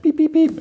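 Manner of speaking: very high, even electronic beeps; keypad beeps. Retold or imitated. He types in a keypad, imitated in high beeps.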